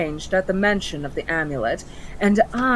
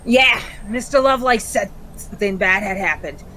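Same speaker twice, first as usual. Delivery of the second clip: cockney imp voice; 4 semitones higher, 5 dB louder, faster than usual. Acted. Imp speaks in role.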